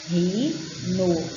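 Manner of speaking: slowly stretched syllable by syllable; drawn out, syllable by syllable. Shown as a demonstration. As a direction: spell rinoceron- out in sound, slowly. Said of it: "olha o ron".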